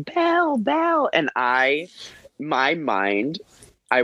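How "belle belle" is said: put-on high excited little-girl calls; girls yelling. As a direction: call her name like an excited little girl.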